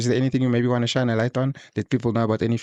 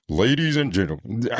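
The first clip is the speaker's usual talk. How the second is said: attempted deep voice. Shown as a demonstration. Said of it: "I've been practicing my deep voice".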